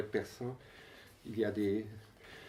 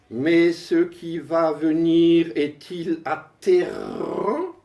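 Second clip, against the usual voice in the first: measured, emphatic recitation with pauses; poem declamation; 6 semitones higher, 8 dB louder, slower than usual. Acted. Poet reads verse about computers to an audience, declaimed.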